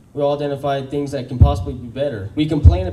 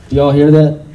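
loud hype question; 10 dB louder than usual. Real, not acted. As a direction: call out to the crowd. Hyping the crowd.

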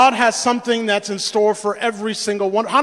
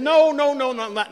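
higher-pitched, hurried string of denials; protesting denial; 5 semitones higher than usual. Acted. Accused brothers answer Joseph's charge of spying, protesting. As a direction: plead innocence, starting high. Brothers deny being spies.

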